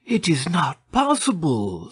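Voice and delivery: high-pitched, stunned disbelief. A narrator's reading. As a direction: refuse to believe it, amazed. Candide stunned at the reunion.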